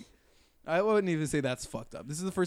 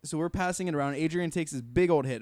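ordinary delivery

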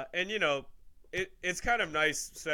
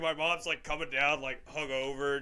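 usual speaking voice

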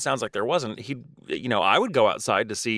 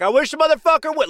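high, loud mocking dare; mock taunt; 14 semitones higher, 6 dB louder than usual. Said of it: "you're gonna taunt them for me".

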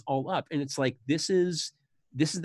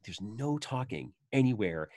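normal talking voice

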